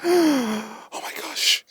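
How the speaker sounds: high, soft, slow gasping panic; hammed-up breathless fright; mock panic. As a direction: pretend panic attack. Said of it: "going into the panic attack like".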